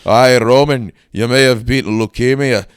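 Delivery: loud Scottish wrestler taunting his rival; Scottish heel calling out Roman in a loud brogue. Acted. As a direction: boom it out in a brash Scottish accent. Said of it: "congratulations, Drew McIntyre".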